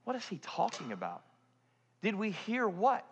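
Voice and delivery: soft, bewildered mock reply; lightly voiced baffled disciples; puzzled disciples. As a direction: hush it like confused followers. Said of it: "they're looking at him like".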